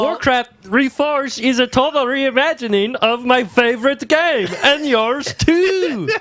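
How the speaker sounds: high, slow laughing sales voice; mock Reforged announcer. Mocking the Reforged presenter, high slow gushing voice. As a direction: gush like a hired host.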